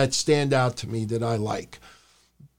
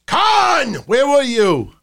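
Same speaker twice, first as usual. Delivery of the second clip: much higher, louder call to the boss; 9 semitones higher, 4 dB louder, slower than usual. Real, not acted. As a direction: shout across to Khan. Calling out to Tony Khan.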